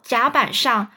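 plain reading voice

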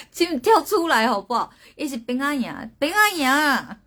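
high, loud plea breaking into laughter; laughing exasperation. Real, not acted. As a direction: plead with them, exasperated and amused. Protests horror guesses, half laughing.

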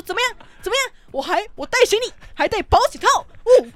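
high, loud taunting shout; reenacting a furious taunt; defiant yelling. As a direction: fling it back in his face. Throwing condoms at grandpa, defiant.

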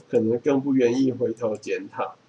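normal talking voice

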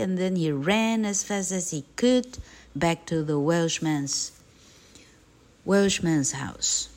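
usual speaking voice

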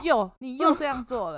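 loud, high mock scold. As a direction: scold her in jest. S1 joins in imitating scolding.

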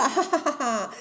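acted cackling "hahaha"; gleeful laugh. A narrator's reading. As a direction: laugh with cheeky delight. Monkey delighted with his plan.

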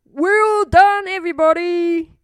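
high, loud congratulation; cheering Elmer. Acted. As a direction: call out praise to the whole group. Elmer congratulates the whole group.

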